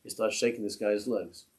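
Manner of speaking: usual speaking voice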